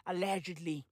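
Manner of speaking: hushed. Shown as a demonstration. Covering himself about mob ties, hushed murmur. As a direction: murmur it like a legal disclaimer. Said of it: "repeats allegedly hushed".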